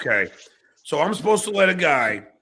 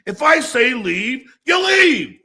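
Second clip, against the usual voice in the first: indignant shouting; 8 semitones higher than usual. Real, not acted. Streamer defending his property rights, indignantly shouting.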